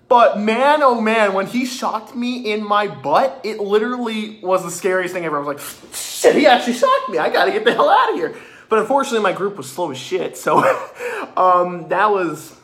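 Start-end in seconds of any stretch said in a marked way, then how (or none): 5.9-8.3 high, loud, fast panicked retelling; mock panic. Runner to self after being shocked, comic panic. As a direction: act out comic alarm, a laugh creeping in.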